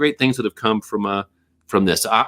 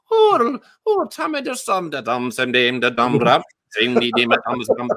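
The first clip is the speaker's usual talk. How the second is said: Irish accent turning Caribbean; 2 semitones higher than usual. Shown as a demonstration. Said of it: "it started off".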